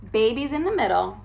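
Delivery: ordinary delivery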